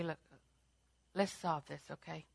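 low, soft, reasonable reply; calm peacemaking. Acted. Believer answering someone who is mad, calmly. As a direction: defuse it quietly, voice dropped. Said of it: "Instead of saying".

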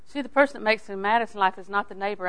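ordinary talk, nothing marked

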